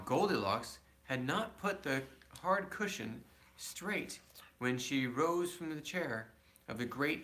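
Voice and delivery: plain reading voice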